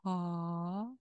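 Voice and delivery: ha as an open long vowel; syllable sounded out. Shown as a demonstration. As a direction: hold the ha out long. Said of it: "So that would be".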